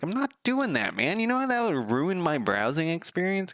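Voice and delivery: high mock outrage at IT people; mock indignant retort.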